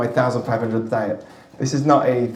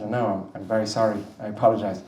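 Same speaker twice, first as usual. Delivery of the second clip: soft, sheepish sorry; lightly voiced sheepish latecomer; quiet apology; 5 semitones lower, 6 dB quieter, faster than usual. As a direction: apologise meekly under the breath. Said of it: "I said".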